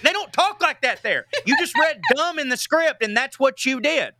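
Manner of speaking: high-pitched comic indignation; indignant retort. Retold or imitated. Southern comedian scolding a TV actor's accent, indignant.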